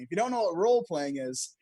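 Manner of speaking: ordinary talk, nothing marked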